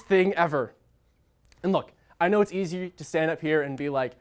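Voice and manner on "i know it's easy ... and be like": ordinary delivery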